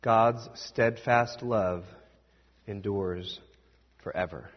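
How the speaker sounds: very slow, low, solemn declaration; reverent proclamation. Real, not acted. Preacher closing the story for the congregation, slow and reverent. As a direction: let each word settle, in awe.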